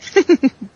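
sudden high laugh; burst of laughter. Real, not acted. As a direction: break out laughing at the accent. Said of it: "I'm laughing at your pron".

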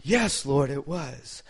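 lightly voiced meek confession; humble answer. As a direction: answer the Lord meekly. Congregation's answer to God.

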